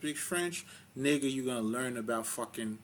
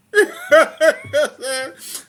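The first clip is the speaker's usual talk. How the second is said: loud laugh; 16 dB louder than usual. Real, not acted. Laughs at his own grammar slip.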